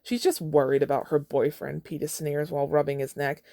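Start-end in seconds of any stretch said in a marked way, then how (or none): none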